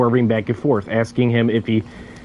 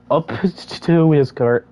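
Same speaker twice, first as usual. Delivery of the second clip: ordinary delivery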